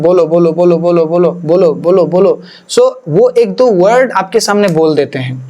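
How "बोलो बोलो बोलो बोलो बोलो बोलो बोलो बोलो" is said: mock insistent, run-together repetition; imitated prodding. People pressing someone to speak in tongues, imitated insistent prodding. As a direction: keep pushing the word without a break, in imitation. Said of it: "बहुत से लोग बोलते हैं".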